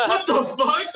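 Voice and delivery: laughing disbelief. Real, not acted. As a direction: laugh it out in shock. Reacting to friend's surprising pick.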